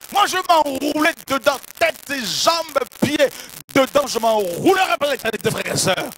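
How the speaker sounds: loud, high, fervent preaching; full-force preaching. Real, not acted. Preacher to brothers and sisters about the robe, full-force preaching. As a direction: preach it to the back row.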